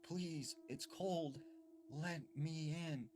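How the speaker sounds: put-on high child's voice, words stretched out; eerie pleading boy. Boy outside in the cold asking to come in, eerie pleading. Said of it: "And he's like".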